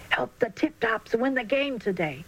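usual speaking voice

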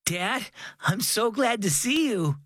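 put-on shaky, relieved little boy; wobbly voice. A narrator's reading. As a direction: voice wobbling with leftover fright. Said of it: "Owen said in a wobbly voice".

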